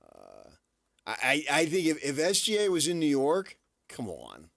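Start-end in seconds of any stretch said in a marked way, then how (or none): 3.9-4.5 New Jersey "come on", vowels rounded; Jersey accent. Real, not acted. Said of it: "You dropped into your Jersey accent for that".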